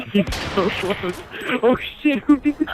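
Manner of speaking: high, loud alarmed cries; game panic. Real, not acted. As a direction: react in shock to the game.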